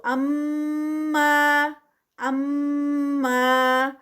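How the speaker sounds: اما with ghunna on ام and a clean two-count ما; tajweed recitation. Shown as a demonstration. Teacher models اما for students in recitation. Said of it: "ام پہ غنہ ہے".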